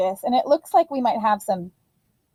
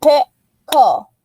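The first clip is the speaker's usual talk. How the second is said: Pickle split into two clear beats, pick and le; teacher clapping out Pickle; syllables clapped; 5 dB louder than usual. Shown as a demonstration. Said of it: "count those syllables with me".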